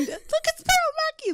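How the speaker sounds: very high, giddy squealing fan; fangirl squeal. Retold or imitated. Teen fan squealing over Peter Capaldi nearby, high excited squeal. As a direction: squeal like a starstruck teenager. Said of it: "squealing about the fact".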